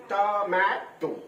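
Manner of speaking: "টমেটো" slowly, last part as toe; split into syllables. Shown as a demonstration. Teacher likening it to toe, "টমেটো" in separate syllables. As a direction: spread "টমেটো" over three beats, to, ma, to. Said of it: "যেরকম উচ্চারণ করো টো".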